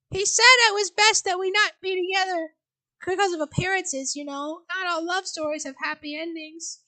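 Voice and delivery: dreamy fond remembering; wistful old mother. Acted. Mother voice continues.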